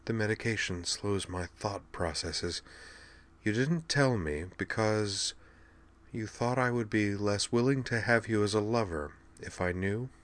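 soft, slowed, thinking aloud; weakened invalid princess. Acted. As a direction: piece it together quietly, medication slowing you.

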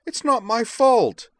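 high, slow, wailing protest; shrieked self-defense; loud protest. A narrator's reading. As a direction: protest your innocence at full voice. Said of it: "Devlin protested".

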